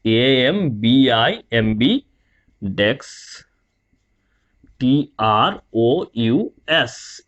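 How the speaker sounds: ambidextrous spelled letter by letter, slowly; spelling out. Shown as a demonstration. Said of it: "एम्बीडेक्स्ट्रस". (एक-एक अक्षर धीरे-धीरे बोलकर spelling)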